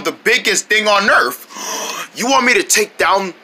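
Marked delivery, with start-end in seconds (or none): 1.6-2.1 sharp unvoiced intake of breath; shocked gasp. Monkey hears of the biggest thing on earth, shocked gasp. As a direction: gasp at the challenge.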